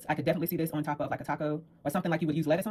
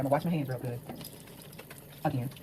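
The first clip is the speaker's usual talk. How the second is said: muffled through a scarf; 5 dB quieter than usual. Real, not acted. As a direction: speak with a scarf over the mouth. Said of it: "excuse me for my scarf".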